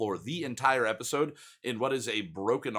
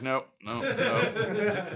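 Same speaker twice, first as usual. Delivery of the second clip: laughing nope; 10 semitones higher, much slower than usual. Retold or imitated. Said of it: "and they're like".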